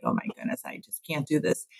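hushed retold dismay. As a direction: say it low in volume, as a private admission. Recalls her own despairing realisation.

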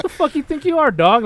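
high-pitched, affronted challenge; hammed-up outrage; mock indignation. Acted quarrel with the dog.

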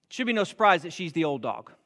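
ordinary talk, nothing marked

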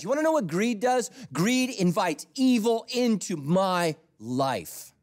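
high, loud, slow pulpit build; fiery sermon climax; preaching at full force. Acted. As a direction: drive it home like a revival preacher.

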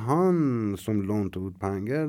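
ordinary delivery, nothing marked

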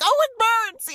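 hammed-up high, loud yelp; mock pained yelp. Mimicking Wesley as if burned, high mock yelp. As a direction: pretend your fingers got burned.

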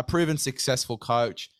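usual speaking voice

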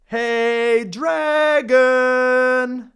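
high, loud long yell; shout. Acted. Princess yells into the dragon's ear. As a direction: yell into his ear as loud as you can. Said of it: "she shouted as loud as she could".

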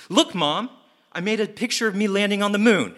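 put-on high, eager little boy; eager kid voice. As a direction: pitch up like a proud child showing his drawing. Young Gru shows mom picture.

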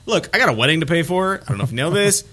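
ordinary talk

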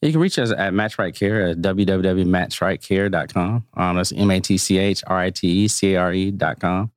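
usual speaking voice

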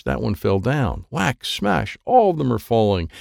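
usual speaking voice

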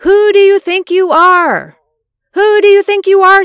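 high, loud furious yell; furious scream. Retold or imitated. Inner scream at the elderly preacher, furious yell. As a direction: scream it at him with outrage. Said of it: "I screamed the words".

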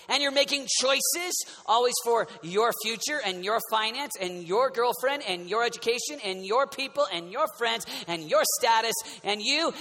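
usual speaking voice

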